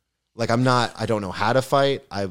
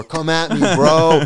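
mock tough-guy taunt rising higher, bro belted louder; Ronnie from Jersey Shore; 6 semitones higher, 8 dB louder, slower than usual. Faking psycho to scare three guys, a hammed-up bro taunt. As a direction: ham up a gym-bro challenge, lifting into bro. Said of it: "like, fucking Ronnie and Jersey Shore".

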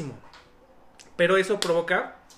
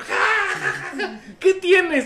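mocking laugh; 12 semitones higher, 6 dB louder, much slower than usual. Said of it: "y el otro".

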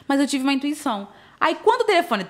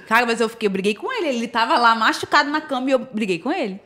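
ordinary talk, nothing marked

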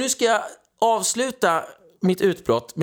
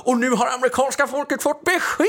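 higher, laughing parody of a thrilled reporter; pitched-up mock triumph; mock satisfaction; 7 semitones higher than usual. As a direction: announce it with gleeful sarcasm. Sarcastically playing a satisfied journalist.

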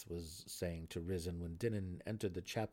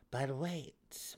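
coyly; 7 semitones higher than usual. A narrator's reading. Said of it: "Malice said coyly".

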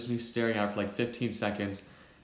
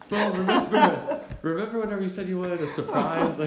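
laughing friend; 8 semitones higher, 5 dB louder than usual. Retold or imitated. Friend reminding narrator he wanted a surprise, laughing tease. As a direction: tease through your own laughter.

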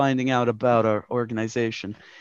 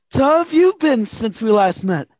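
put-on a much higher, louder young boy's voice; boy detective; 8 semitones higher, 7 dB louder than usual. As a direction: play the eager kid detective greeting his boss. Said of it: "Angus McDonald, boy detective".

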